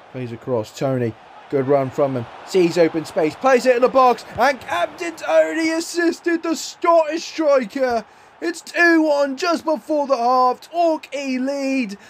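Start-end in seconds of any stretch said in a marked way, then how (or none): 3.4-12.0 higher, excited, slowed-down goal call; goal celebration. Real, not acted. Gamer calling his team's goal, thrilled and higher. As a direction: savour the go-ahead goal.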